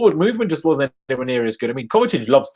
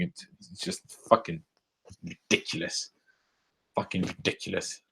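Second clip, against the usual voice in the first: muttered disgust; 7 semitones lower, 13 dB quieter than usual. Real, not acted. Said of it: "I'm just pissed off".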